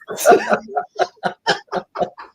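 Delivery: high-pitched burst of laughter. Real, not acted. Laughing at the memory.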